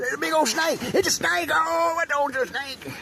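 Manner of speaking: high, hammed-up alarm; faked snake scare; mock panic. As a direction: cry out in pretend terror, high and stretched at the end. Mock panic at cord called a snake.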